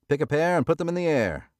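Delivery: high, loud casino patter; shouting the dealer's chant; stickman shout. A narrator's reading. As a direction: sell the roll to the whole table. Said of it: "the stickman shouted".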